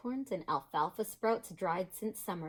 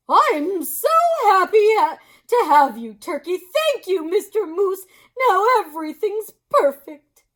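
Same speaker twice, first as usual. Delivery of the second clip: high, louder delighted voice; delighted Missus Moose; 12 semitones higher, 14 dB louder than usual. Acted. Missus Moose delighted to greet her Turkey guest. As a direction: gush with delight. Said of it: "clapped her hooves".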